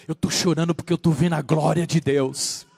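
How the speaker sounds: low-pitched, restrained testimony; low solemn testimony. Retold or imitated. Believer tells why they weep, low and solemn. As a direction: say it low and gravely. True crier's answer, first low take.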